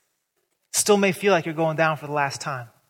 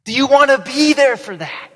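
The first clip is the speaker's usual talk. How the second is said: higher, louder challenge; 7 semitones higher, 8 dB louder than usual. Shown as a demonstration. Preacher rallying the congregation, loud and high. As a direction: lift the room with the question.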